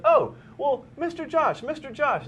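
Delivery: put-on high, piping little boy voice; little kid voice. Host mimics a kid on the street, high little boy voice. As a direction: squeak it like a small child tugging a shirt. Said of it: "And he said".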